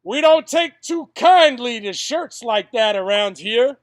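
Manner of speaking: loud, slow, threatening local voice; menacing local. Retold or imitated. Local gunman warning a man over his shirt, menacing. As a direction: warn off an outsider, loud and drawn out. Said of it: "that's what I was told. With a gun pointed".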